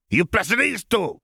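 high, slow squeaky alien waitress; temperamental waitress. Acted. Tiny alien waitress scolding the admiral, high and slow. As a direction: drag it out, bossy and tiny.

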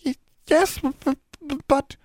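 very high elf voice, stuttering; a tiny scared creature tripping over words; stammering house elf. A narrator's reading. Frightened elf stuttering a reply to Alexandra, very high. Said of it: "Triss stammered again".

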